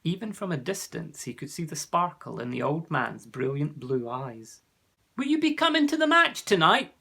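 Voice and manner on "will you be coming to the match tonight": high, loud call across the street; shout. A narrator's reading. Jonathan shouts to old Mister Grey. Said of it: "Jonathan shouted to him".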